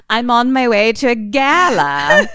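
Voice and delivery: high, loud fussy socialite; posh lady voice. Retold or imitated. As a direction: play a spoiled gala guest in a panic. Mocking a posh gala customer.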